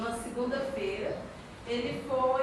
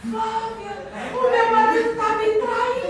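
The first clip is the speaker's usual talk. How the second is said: retold despair; 9 semitones higher, 7 dB louder, slower than usual. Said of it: "E eu".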